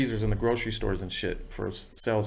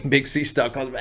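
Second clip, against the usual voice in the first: ordinary talk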